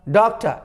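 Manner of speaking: डॉक्टर said as doktə with no r; silent final r. Shown as a demonstration. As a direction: drop the r at the end of डॉक्टर. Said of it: "रॉ को silent के बराबर कर देना है".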